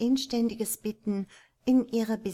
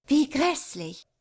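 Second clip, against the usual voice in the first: higher, louder, drawn-out exclamation; appalled cry; 7 semitones higher, 5 dB louder than usual. A narrator's reading. Mrs Otis reacting to blood on the floor, appalled cry. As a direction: cry out slowly in horror. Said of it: "rief Misses Otis".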